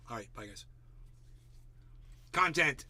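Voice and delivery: usual speaking voice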